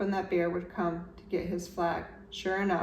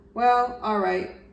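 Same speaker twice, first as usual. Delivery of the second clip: reluctant agreement; 3 semitones higher, 6 dB louder, slower than usual. A narrator's reading. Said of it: "Bear finally agreed".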